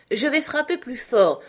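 threatening Manxit. A narrator's reading. Manxit threatening Fata mid-beating, menacing. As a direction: promise a harder blow.

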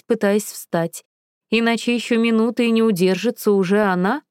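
ordinary delivery

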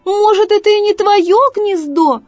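high, loud fox call; calling after. Acted. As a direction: shout it after someone flying off. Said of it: "закричала Лиска Лариска ей вслед".

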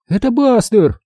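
put-on high-pitched girl's exclamation; excited cry. A narrator's reading. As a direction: cry out high in sudden hope. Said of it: "закричала Минди". (радостный вскрик девочки)

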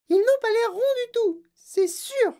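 put-on very high, loud child's cry; Veruca Salt. A narrator's reading. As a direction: shout it in a piercingly high little-girl voice. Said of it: "cria Veruca Salt". (cri suraigu de fillette)

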